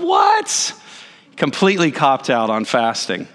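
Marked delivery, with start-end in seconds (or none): 0.0-0.7 sudden high squeak of disbelief; shocked what. Retold or imitated. Startled preacher hearing the sermon skip fasting, squeaking. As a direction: yelp it like the rug was pulled out. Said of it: "And I went".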